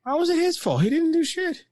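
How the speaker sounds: high, hammed-up character voice; mock dubbed voice. Gamer voicing the character's protest, high-pitched. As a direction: ham it up as the game character.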